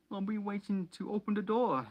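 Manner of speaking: high, put-on boy's voice; squeaky spoiled schoolboy line; Dudley's voice. A narrator's reading. Dudley voiced in read story.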